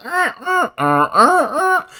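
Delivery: high, creaky little metal noises; imitated armor squeaks. Showing how every little movement sounds, high squeaky noises. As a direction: mimic stiff armor squeaking with each movement.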